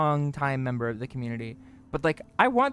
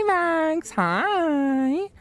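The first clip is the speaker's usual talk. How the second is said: cute greeting; 12 semitones higher, 4 dB louder than usual. Acted.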